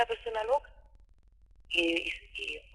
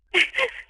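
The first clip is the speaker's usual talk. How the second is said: sudden high, louder sob; a sob breaks through the words; crying out mid-story; real sob; 12 semitones higher, 6 dB louder than usual. Real, not acted. Crying while telling her story.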